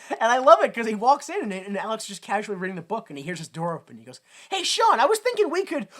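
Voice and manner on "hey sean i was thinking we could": high-pitched mock-eager call; bright-eyed kid pitching an idea, hammed up; eager greeting. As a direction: burst in chirpy and high, acting someone out. Acting out a character's cheerful call.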